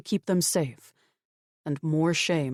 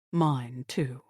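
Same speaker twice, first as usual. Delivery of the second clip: ordinary delivery, nothing marked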